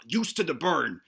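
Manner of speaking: normal talking voice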